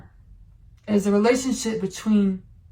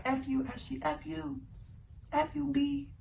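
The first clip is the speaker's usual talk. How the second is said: a touch of a sneering inner voice, higher and much softer; hissed inner cursing; 5 semitones higher, 12 dB quieter than usual. Retold or imitated. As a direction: spit it at yourself under your breath, higher. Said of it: "I had the energy today, like".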